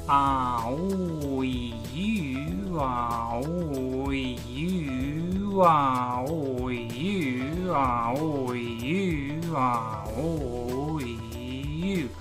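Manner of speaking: slow, open vowels ah oh you; articulation drill. Shown as a demonstration. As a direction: shape each vowel wide and slow. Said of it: "So let's give it a try".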